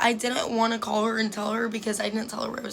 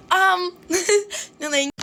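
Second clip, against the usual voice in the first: high, louder awkward giggle into a refusal; nervous laugh; 8 semitones higher, 4 dB louder than usual. Retold or imitated. Freshman turning down the seniors, nervous laugh. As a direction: laugh it off to dodge the offer.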